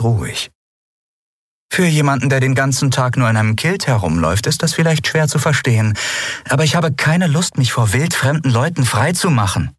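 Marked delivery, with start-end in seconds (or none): 1.7-9.7 put-on young woman's voice, pitched higher, standing her ground; a lightly voiced young woman refusing to undress; Lien's defiant voice. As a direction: answer coolly but firmly, a higher young voice. Lien answers Kero defiantly.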